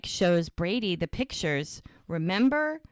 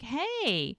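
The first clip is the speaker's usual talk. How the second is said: higher-pitched retold hello; mildly surprised greeting; 6 semitones higher than usual. Bree opens her door to find Mike, mildly surprised. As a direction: lightly act out finding an unexpected visitor.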